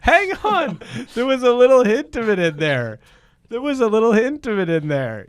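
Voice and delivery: very high, loud real excitement; excited praise. Real, not acted. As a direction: squeal with delighted surprise.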